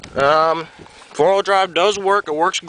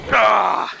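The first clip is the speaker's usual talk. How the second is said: strain grunt; 6 semitones higher than usual. Real, not acted.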